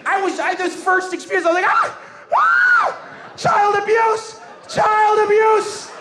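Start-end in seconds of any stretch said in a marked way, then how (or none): 1.7-3.3 very high acted yelp and scream; pained scream. Slapped pupil screams. 3.4-5.9 high, loud, drawn-out hammed-up alarm; crying child abuse. Slapped pupil cries for help.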